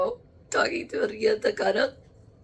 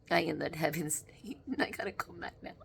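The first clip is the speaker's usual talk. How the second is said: soft unvoiced whisper, speeding up at the end; 4 semitones lower, 10 dB quieter than usual. Real, not acted. Medium lost in bliss, whispering to the camera. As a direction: whisper it dreamily.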